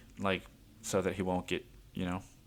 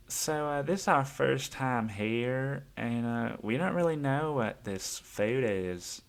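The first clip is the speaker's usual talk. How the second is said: slow, higher Southern woman's voice; a mild put-on Southern drawl, higher and slower; Southern accent; 4 semitones higher, slower than usual. Customer asking about the food, in a slow higher Southern accent. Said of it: "more Southern than Texan, like, as far as accents goes".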